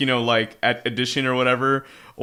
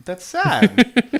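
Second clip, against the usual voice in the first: slow, drawn-out mock pity; milk the sadness, slowly; mock sad; 13 semitones higher, 4 dB louder than usual. Mock sorrow for a failing cartoon elephant, slow and drawn out.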